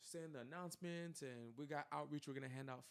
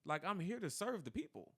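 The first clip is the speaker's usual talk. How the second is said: loud protest; 6 semitones higher, 9 dB louder than usual. Retold or imitated. Protesting to the pastor.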